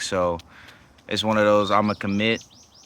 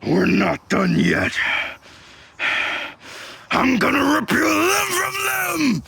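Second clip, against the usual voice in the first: booming villain monologue. Shown as a demonstration. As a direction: roar it like an enraged villain. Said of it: "evil villain voices".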